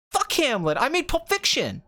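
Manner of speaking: high-pitched, boastful director outburst; hammed-up excitable ego; Quentin Tarantino. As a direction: brag indignantly, pitch shooting up.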